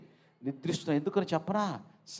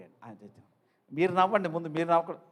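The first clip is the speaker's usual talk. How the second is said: ordinary talk, nothing marked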